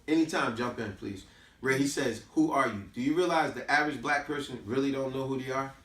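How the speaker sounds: ordinary delivery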